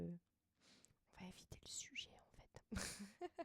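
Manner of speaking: slow, hushed whisper; sheepish whisper, very soft; whispered aside. Real, not acted. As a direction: whisper it as if dropping an awkward subject. Backs away from an awkward digression.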